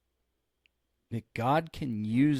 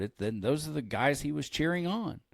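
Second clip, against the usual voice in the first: normal talking voice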